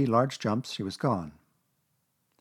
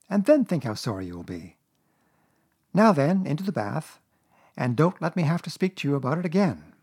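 raised, scolding mother voice; rather severe Kanga; 6 semitones higher, 4 dB louder than usual. A narrator's reading. Kanga warning Roo about making faces, severe. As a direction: scold the child firmly, pitched up. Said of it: "said Kanga rather severely".

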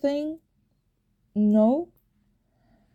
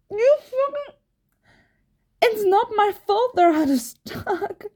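exasperated; 10 semitones higher than usual. Acted. Exasperated at boyfriend.

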